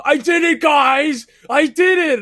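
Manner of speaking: high, loud scream to friends; imitated triumphant scream. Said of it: "I hear them screaming".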